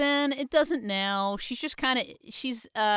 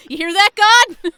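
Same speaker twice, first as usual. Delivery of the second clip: calling out loud; 11 semitones higher, 15 dB louder, faster than usual. Real, not acted. Calling up to God excitedly.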